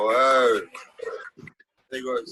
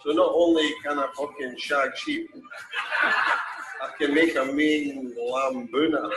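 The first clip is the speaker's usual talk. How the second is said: ordinary talk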